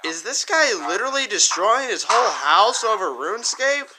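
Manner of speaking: high, loud, disbelieving outcry; incredulous. Real, not acted. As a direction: shriek in total disbelief. Watching streamer wreck his house.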